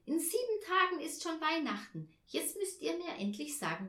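put-on high-pitched eager girl; lightly voiced child, urging her parents on; excited calling out. A narrator's reading. Tina begging parents for their Christmas wishes, excited. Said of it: "rief sie".

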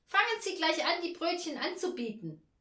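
high, loud hurried order; bossy hostess. A narrator's reading. Hostess mistaking the angel for a waiter, bossy and loud.

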